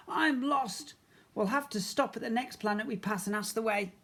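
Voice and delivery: high, fretful spaceman voice; worried spaceman. A narrator's reading. Spaceman to Mister Ben, lost in space, high and fretful. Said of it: "the spaceman said".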